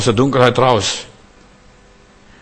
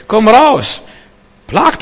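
far higher and louder; commanding call; 9 semitones higher, 5 dB louder, much slower than usual. Acted. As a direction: call them out of their sins. Calling sinners out.

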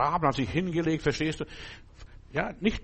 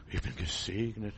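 smug murmur; 5 semitones lower, 9 dB quieter than usual. Retold or imitated. Mocking a complacent believer.

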